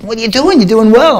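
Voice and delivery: high, loud official's shout of disbelief; shouted question. Retold or imitated. Race officials yell at the quitting athlete, high and loud. Officials confront him in car.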